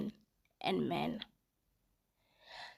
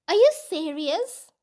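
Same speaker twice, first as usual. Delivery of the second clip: indignant lady; 6 semitones higher, 5 dB louder than usual. Retold or imitated. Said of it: "I hear a lady go".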